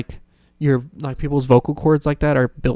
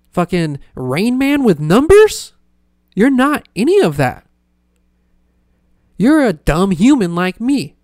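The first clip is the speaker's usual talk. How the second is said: ranting yell; 6 semitones higher, 5 dB louder than usual. Real, not acted. Ranting at people doing mental math.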